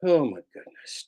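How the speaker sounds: ordinary talk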